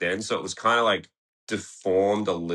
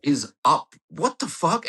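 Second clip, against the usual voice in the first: shocked disbelief; 10 semitones higher, 3 dB louder, slower than usual. Retold or imitated. Seeing his sideways thumb in photo.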